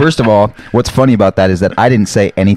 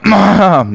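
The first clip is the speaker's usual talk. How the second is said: throat clearing; 10 semitones higher than usual. Real, not acted. Preparing to chug.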